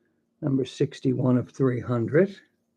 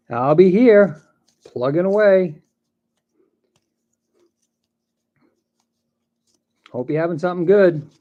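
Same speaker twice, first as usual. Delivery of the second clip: calling out; 7 semitones higher, 11 dB louder than usual. Real, not acted. Calls out to viewers.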